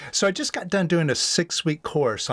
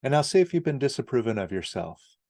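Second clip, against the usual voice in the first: lower, softer meditation guide with long pauses; guided meditation; 6 semitones lower, 4 dB quieter than usual. Acted. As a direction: gently lead the listener through letting go, leaving long silences.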